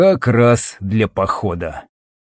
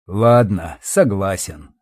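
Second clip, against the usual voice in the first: low, resigned surrender; giving in; 4 semitones lower, slower than usual. Acted. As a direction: give up with a sigh. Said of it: "сдался парень".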